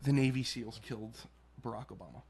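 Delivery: soft and low, fading to almost nothing; gentle wake-up voice. Retold or imitated. Mom gently wakes her sleeping son with news, soft. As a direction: murmur it gently like waking a sleeping child. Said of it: "gently shook me awake, and just goes".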